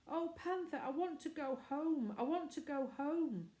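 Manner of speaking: put-on high, pleading little girl in tears; crying child. A narrator's reading. Scared girl begging her sister to go home, tearful. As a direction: beg to go home, close to sobbing. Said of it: "Let her cry".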